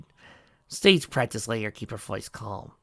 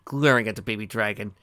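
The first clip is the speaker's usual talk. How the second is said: plain reading voice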